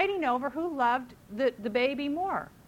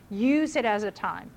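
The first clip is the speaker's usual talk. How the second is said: ordinary talk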